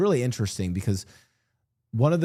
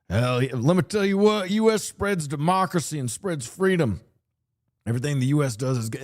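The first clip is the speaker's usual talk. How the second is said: higher, hyped-up mock American cheerleader; gung-ho patriot; 4 semitones higher than usual. Mocking a flag-waving patriot to her viewers, hyped and high. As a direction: ham up a flag-waving booster. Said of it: "But my view is also".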